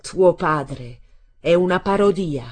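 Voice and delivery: loud, slow verdict; exclaiming. A narrator's reading. Stella mocking the father to his son, exclaiming. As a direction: pronounce it slowly and forcefully. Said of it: "ed esclamò".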